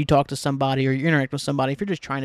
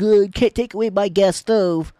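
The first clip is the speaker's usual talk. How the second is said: mocking Southerner; 5 semitones higher, 6 dB louder than usual. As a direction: play the dumb complainer, loud. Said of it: "And they're like".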